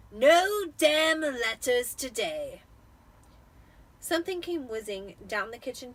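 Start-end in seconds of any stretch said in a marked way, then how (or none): 0.1-2.6 slow, loud, higher gloating uncle; cheerful Vernon. A narrator's reading. Vernon reminding the family, slow and gloating. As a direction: sing it out smugly.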